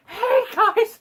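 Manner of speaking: very high, loud manic greeting; crazed, over-friendly squeal; hammed-up loony scientist voice; Brett Spiner. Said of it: "the fucking craziest fuck you'll ever meet".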